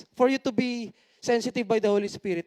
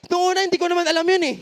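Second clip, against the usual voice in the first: excited rush; 5 semitones higher, 4 dB louder, much faster than usual. Real, not acted. Excited fast exclamation.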